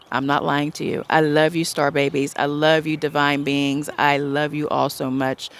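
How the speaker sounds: ordinary delivery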